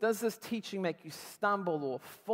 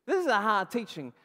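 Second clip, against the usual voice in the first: louder, pushy complaint; disciples complaining; 4 semitones higher, 7 dB louder than usual. Acted. Disciples protesting to Jesus, loud complaint. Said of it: "That's their question".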